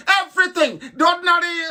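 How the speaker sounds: shouted rant. Real, not acted. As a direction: yell it out in outrage. Ranting, shouting at listeners.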